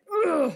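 a short, loud, high ugh of frustration; frustrated yell. Retold or imitated. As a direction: let out a sharp ugh like a scream. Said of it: "así como loca". (un ugh fuerte y agudo de rabia)

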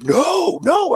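high, loud retold protest; frantic high-pitched cry of refusal; panicked refusal. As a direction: act out a kid refusing in panic. Retold refusal of the dentist's gas.

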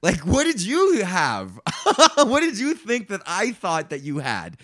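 incredulous questions breaking into laughter; laughing disbelief. Retold or imitated. Player questioning opponent's baffling hand, incredulous and laughing. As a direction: stretch the question, then crack up.

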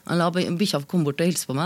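ordinary talk, nothing marked

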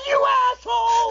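very high mock shriek; tearful outburst, imitated. DJ discovering the rib, hammed-up tearful shriek. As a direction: imitate her squealed outrage. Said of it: "she got tears in her eyes".

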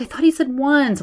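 put-on high childish whine; whiny man child. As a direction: whine like a sulky kid. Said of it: "whining like a child".

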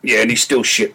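normal talking voice

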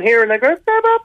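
very high, about to pop; climbing Cork lilt. Shown as a demonstration. Comedian mimics Cork people about to pop, very high pitch. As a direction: squeak up to bursting in mock Cork. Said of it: "they go higher and higher, and it sounds like they're gonna cry".

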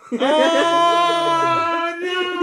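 loud, very high, drawn-out wail of shock; real dismayed outcry. Real, not acted. Friend hearing it was her brother, loudly dismayed. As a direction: cry out long and high in horrified amusement. Listener reacts to embarrassing reveal.

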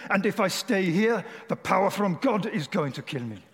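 put-on dramatic German-accented storytelling; German accent, climbing to high alarm; Reinhard Bonnke. Boy torn between father and God, alarmed German accent. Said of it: "Doing my best German accent".

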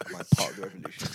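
quick hushed aside; fast whisper. Real, not acted. Wondering aloud to friends whether it's a revolution, fast whisper. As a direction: whisper it fast like a secret thought.

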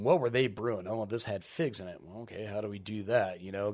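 ordinary talk, nothing marked

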